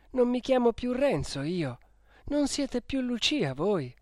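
wounded, insistent protest; hurt reproach. A narrator's reading. Renzo reminding Lucia who they are, hurt and indignant.

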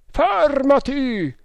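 loud, high, long-drawn shout; thundering order. A narrator's reading. Pursuer ordering the boat to halt, thundering drawn-out shout. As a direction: thunder it out with stretched vowels. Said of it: "tuonò una voce".

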